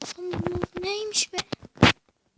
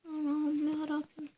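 normal talking voice

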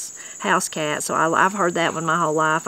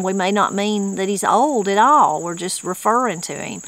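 usual speaking voice

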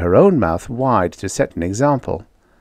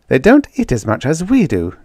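put-on raised-pitch girlish recital; girl quoting; 6 semitones higher, 3 dB louder than usual. A narrator's reading. Jane puts in a borrowed argument, obviously quoting. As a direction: recite another's argument as your own. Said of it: "obviously quoting".

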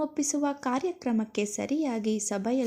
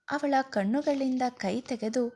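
ordinary delivery, nothing marked

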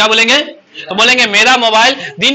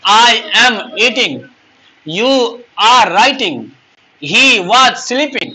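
very slow, each word spelled out for copying; slow dictation; much slower than usual. Shown as a demonstration. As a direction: dictate it slowly so students can write. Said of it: "देखो ना". (धीरे धीरे बोलकर लिखवाना)

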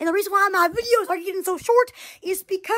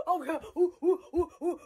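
usual speaking voice

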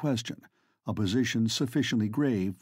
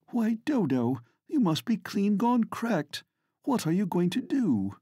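shocked cry; 7 semitones higher than usual. A narrator's reading. Vivi staring at her friend's change, high shocked voice. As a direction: gasp it out as if she's lost her mind. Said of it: "friend cries out in shock".

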